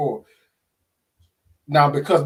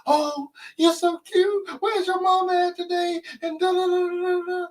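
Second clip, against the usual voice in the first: put-on high, sweet sing-song lady gushing over a kid; high baby-talk gush at a little girl; gushing cashier. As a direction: coo it like a stranger doting on a cute child. Cashier gushing over cute child.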